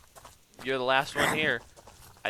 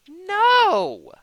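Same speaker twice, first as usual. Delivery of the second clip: much higher, loud "No!"; frustrated shout; 13 dB louder than usual. Real, not acted. Builder shouting at the game in frustration, loud no. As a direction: yell in dismay.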